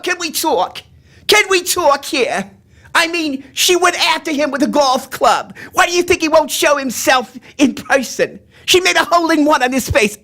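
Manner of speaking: high, brash comedienne voice; Joan Rivers. Shown as a demonstration. Comedienne dishing gossip to her audience, high brash voice. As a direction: pitch it way up, dishing with exaggerated outrage. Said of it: "That's Joan Rivers".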